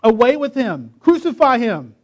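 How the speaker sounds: loud, high crowd demand; crying out. A narrator's reading. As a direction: cry out like a mob calling for execution. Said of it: "They cried out".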